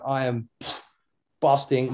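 usual speaking voice

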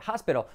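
"hospital" as HAS-pero; pronunciación correcta. Shown as a demonstration. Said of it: "como si fuera áspero". ("hospital" como «jáspero»)

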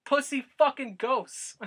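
loud, high, slow taunting yell; slow, drawn-out yell, pitched high; mock yell at ghosts. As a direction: bellow it up at the ghosts like a dare. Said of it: "And I'll scream".

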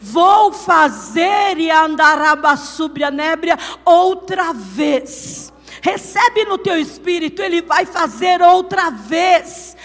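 loud, long-held words of a fiery Pentecostal proclamation; preach at full force. Real, not acted. Preacher declaring God will do it for the congregation, full-force preaching. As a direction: proclaim the promise with building power.

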